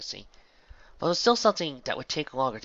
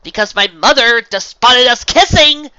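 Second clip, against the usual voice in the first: high, loud heated yelling; furious shouted retort; shouting; 13 semitones higher, 19 dB louder than usual. A narrator's reading. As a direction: hurl it back mid-argument. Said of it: "the girl shouted".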